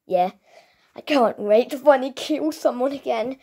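ordinary talk, nothing marked

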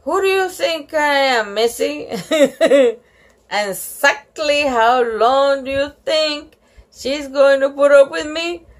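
mother giving a stern little talk; mad mother. A narrator's reading. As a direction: scold the child firmly. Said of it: "Mother is mad at me and she says".

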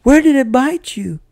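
high, loud panicked question; alarmed shout. A narrator's reading. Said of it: "grabbed me and shouted".

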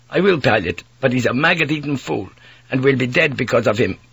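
put-on a weary young Dutch sailor pleading; Dutch mate.

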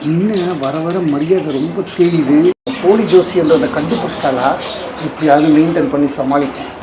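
worried aside. Acted. Fake astrologer's worried aside.

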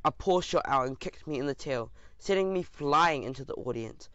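ordinary delivery, nothing marked

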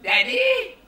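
put-on high, loud toddler calling "Daddy?"; little daughter's voice. Preacher imitating his toddler daughter asking him for toast, high and loud. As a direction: squeak it up like a small girl asking for something. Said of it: "So she comes up and said".

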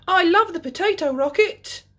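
very high, loud joyful shout; Peppa shouting. A narrator's reading. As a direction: belt it out in delight. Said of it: "shouts Peppa".

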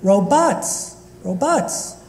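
robots, full second vowel, rising; imitating nephew's pronunciation. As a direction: say robots with an open "bahts". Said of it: "you didn't say it exactly that way".

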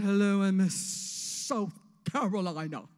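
loud, drawn-out pageant introduction; slow, projected beauty queen voice; generic pageant queen. Acted. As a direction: stretch out your state's name like a contestant. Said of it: "we sound just alike".

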